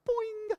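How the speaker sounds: high cartoon boing sound; spring up with a comic boing; silly boing noise for a startled parent; mock boing. Parent jolted awake, mock sound.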